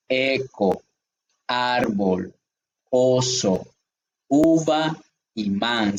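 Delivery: each word alone, with a clear pause after it; paused, emphasized words. Shown as a demonstration. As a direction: read the list slowly, one clearly articulated word at a time. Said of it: "siempre dando la pausa y siempre, eh, enfatizando".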